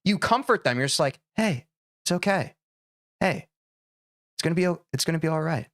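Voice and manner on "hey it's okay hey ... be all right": softer, gentle soothing voice; soft and comforting. Retold or imitated. Parent soothing a tantruming child, soft and gentle. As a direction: hush them like a comforting parent. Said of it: "You get soft. You get quiet".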